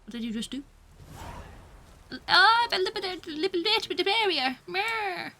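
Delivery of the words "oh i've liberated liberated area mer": high-pitched garbled goofy boast; mocking, slurred nonsense voice; silly mock voice. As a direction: babble it high like a silly cartoon hero. Silly voicing, then repeated plainly.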